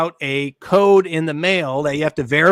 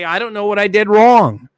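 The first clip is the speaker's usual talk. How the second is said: a puzzled seller's protest, higher and louder; confused seller; 4 semitones higher, 5 dB louder than usual. Retold or imitated. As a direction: play the baffled seller who has no idea what went wrong. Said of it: "and they say".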